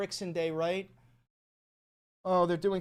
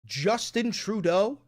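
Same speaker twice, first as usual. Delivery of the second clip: ordinary talk, nothing marked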